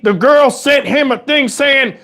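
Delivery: ordinary talk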